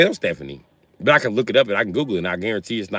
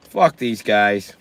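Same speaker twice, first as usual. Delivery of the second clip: slow, spoken out plainly; dismissive; much slower than usual. Acted.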